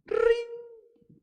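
imitated ringing, very high; phone ring. Shown as a demonstration. Preacher imitating a phone ringing for an example, high ring. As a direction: chirp out a telephone ring. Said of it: "este ejemplo".

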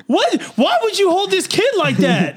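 very high, loud outraged yelp; scolding his mom in a panicked shriek; a teen protecting his doll, shrieking at mom; shocked shriek. Retold or imitated. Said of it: "I was like".

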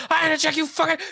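high-pitched mock indignant comeback; mock indignant retort. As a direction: squeal the comeback in fake outrage. Retorting insulted person.

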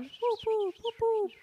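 high chirped "whoop" bird calls. Shown as a demonstration. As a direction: mimic a bobbing wagtail. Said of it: "I can hear it bobbing up and down".

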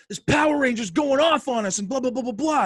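high, loud mock rant; imitating angry ranting. Wrestler imitating his worked-up friend ranting about a woman, angry mock rant. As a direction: run the blahs together in a high, worked-up squawk. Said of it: "Why are you so angry?".

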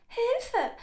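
high, loud mouse squeal; squeaking mouse. A narrator's reading. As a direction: squeak for help like a caught mouse. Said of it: "quiekte die Maus".

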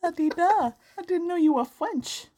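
high, put-on French accent; bad French accent. Host mocking the correction, in a failed French accent. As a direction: tease in a mock French accent. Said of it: "that was my French accent".

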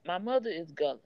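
ordinary talk, nothing marked

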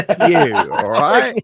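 loud, high-pitched comic indignation; mock outrage. Real, not acted. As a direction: tell your friend off, playfully outraged. Mock outrage at friend's teasing.

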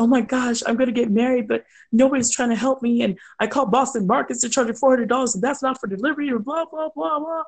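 high, fast, breathless panic; mock frantic bride rattling on. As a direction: gush like a freaked-out bride. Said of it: "she's a freaked out bride".

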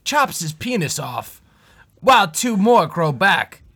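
grand wildlife narration, slowing at end; lightly voiced nature documentary; nature documentary narrator. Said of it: "Steve Irwin just narrating".

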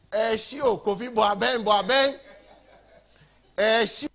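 high, loud mocking shout with laughter; angel shouting. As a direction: shout it across the fire like a jeering angel. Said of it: "one angel is shouting".

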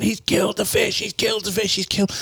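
high, anguished mock wail; distraught wailing. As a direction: wail it like your pet just died. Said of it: "is going".

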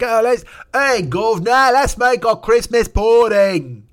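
hammed-up old English accent, high, loud and slow; silly olde-England toff calling his governor, slow and loud; mock old English. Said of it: "I don't know who's doing that voice".

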